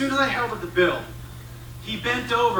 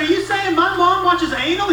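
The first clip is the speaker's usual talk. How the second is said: high-pitched indignant dad; acted-out affronted question, pitched up; outraged disbelief; 8 semitones higher, 4 dB louder than usual. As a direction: demand an answer, scandalized. Dad challenges son, outraged.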